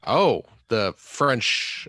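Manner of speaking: normal talking voice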